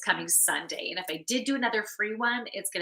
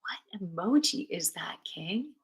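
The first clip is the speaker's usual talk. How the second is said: ordinary talk, nothing marked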